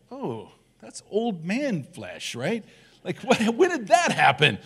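playfully horrified at his own skin; mock dismay. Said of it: "every now and then I'm like".

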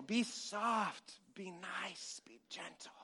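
soft words sinking to a whisper; fading to a whisper. Shown as a demonstration. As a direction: hush down word by word to a whisper. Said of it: "You gotta whisper when you're gentle".

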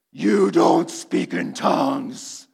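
slow, low eerie demonic growl. Retold or imitated. Bike thief speaking in an eerie demonic voice to the preacher. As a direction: drag the words like something possessed.

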